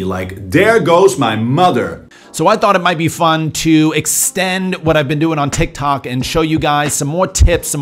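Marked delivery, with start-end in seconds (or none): 0.5-2.1 "There goes my mother" with D for the TH. Shown as a demonstration. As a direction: Dutch D sounds in "There" and "mother". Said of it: "for a T or a D".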